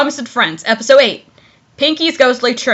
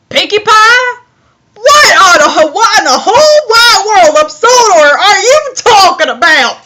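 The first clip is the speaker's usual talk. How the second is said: put-on annoying southern accent; 7 semitones higher, 5 dB louder than usual. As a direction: ham up an irritating American South accent. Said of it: "I made that southern accent really annoying".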